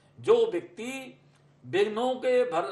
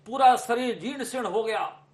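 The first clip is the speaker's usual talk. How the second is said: normal talking voice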